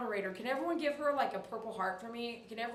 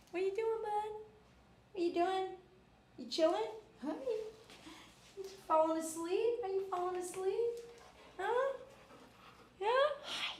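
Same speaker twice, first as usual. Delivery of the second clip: much higher, sing-song pet talk; talking to the dog; 10 semitones higher than usual. Real, not acted. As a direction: coo at a drowsy puppy. Talking to her sleepy dog.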